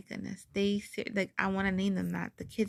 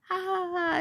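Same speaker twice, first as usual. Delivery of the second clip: high fake ha ha ha; hammed-up laughing imitation; mock laughter; 10 semitones higher, 4 dB louder than usual.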